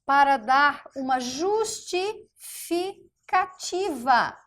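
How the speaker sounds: para dar uma justificativa drawn out slowly; slow emphasis. Shown as a demonstration. Teacher to class, slow emphatic explanation. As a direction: lay it out word by word. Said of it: "Ele é usado".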